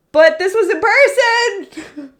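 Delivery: high, loud, embarrassed laughing exclamation; laughing protest. Real, not acted. Streamer telling chat the missed joke was in person, laughing protest. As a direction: protest loudly through laughter.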